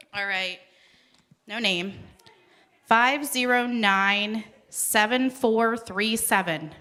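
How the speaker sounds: ordinary talk, nothing marked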